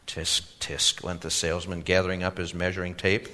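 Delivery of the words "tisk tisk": tutting. A narrator's reading. Salesman clicks tongue.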